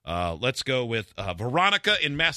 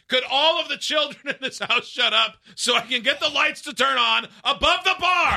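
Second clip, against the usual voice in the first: a hint of higher exasperated ranting; mock exasperation; 13 semitones higher than usual. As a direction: rant like a fed-up homeowner. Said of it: "mock voicing listener's complaint".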